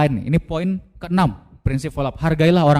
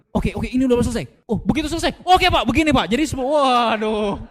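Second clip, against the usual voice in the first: eager to jump in; 7 semitones higher than usual. Retold or imitated. Salesperson finally gets to answer the prospect, high eager rush. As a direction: burst in, unable to wait any longer. Impatient listener jumping in.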